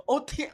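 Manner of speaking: "Outing" said as "oti" through a high laugh; mocking the clip's pronunciation; mocking mispronunciation. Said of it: "Sino yung nagsasabi ng outing?".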